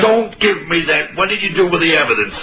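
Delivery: jab the accusation at him; angry accusation. Acted. American accusing the lawyer of murder, heated.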